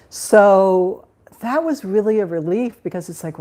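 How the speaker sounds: ordinary talk, nothing marked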